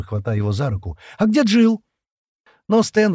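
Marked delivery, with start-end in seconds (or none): 1.2-1.8 high, loud demand; shouted question. A narrator's reading. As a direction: cry it out, pitched right up. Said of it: "крикнула Шира". (громкий высокий выкрик)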